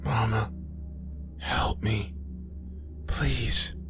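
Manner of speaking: high, faint, fading cry for Mama; weak plea. Acted. Fading Aisha begs her mother for help, weakly pleading. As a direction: beg for help with failing strength.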